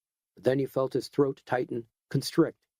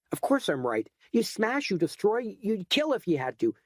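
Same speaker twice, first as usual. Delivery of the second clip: much higher, building outrage; angry rant; 10 semitones higher than usual. Acted. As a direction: tear into him, rising all the way. Edgar rants at the hoodlum.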